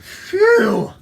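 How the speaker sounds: high, loud whew; big acted sigh of relief; relieved phew. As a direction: blow out relief at a near miss. Frog relieved to escape skunk.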